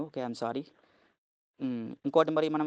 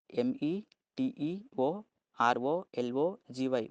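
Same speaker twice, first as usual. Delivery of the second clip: slow dictation; much slower than usual. Shown as a demonstration. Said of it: "గుర్తుపెట్టుకోండి".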